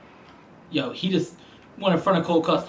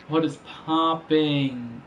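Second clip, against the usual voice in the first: slow drawn-out greeting; much slower than usual. Real, not acted.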